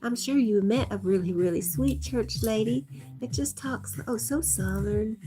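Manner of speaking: sweet Southern church lady. Acted. Streamer describing church ladies she knew, as a sweet Southern church lady. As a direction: slip into a sugary church lady voice. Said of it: "I bring out the sweet church lady".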